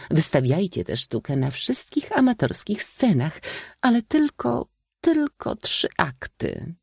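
high, theatrical spinster speech; comic old maid. A narrator's reading. Old maid urging the young on to the show, comic declaiming. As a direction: perform it grandly, climbing high and slowing at the end. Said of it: "Strojąc komiczny grymas, odparła zagadnięta".

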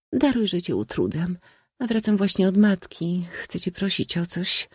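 put-on high, pleading girlish voice; timid young princess. A narrator's reading. Sister begging her brother for a favour, timid pleading.

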